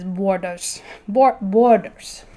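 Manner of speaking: playful mock Russian accent, "borders" slowed and stretched; laughing mock Russian accent, repeating "borders" with the B close to a W; Russian accent imitation. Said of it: "every time she says".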